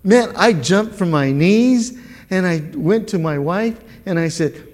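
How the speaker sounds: normal talking voice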